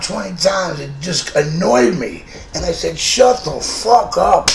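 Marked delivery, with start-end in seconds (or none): 3.0-4.5 loud, slow and menacing; retold threat, slow and louder; slow angry snap. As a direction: bite off each word. Retold snapping at roommate.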